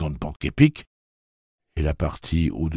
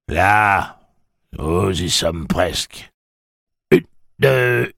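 loud rallying call with drawn-out words; gruff order to keep going; urging call; 4 semitones higher, 7 dB louder, much slower than usual. A narrator's reading. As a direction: call out the marching count. Villain urging a runner on.

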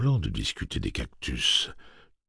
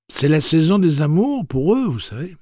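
high and loud, a smug prissy robot; loud superior android tone; condescending, slightly effeminate; 12 semitones higher, 10 dB louder than usual. A narrator's reading. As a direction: lecture him as if he were a child. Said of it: "de sa voix condescendante et légèrement efféminée".

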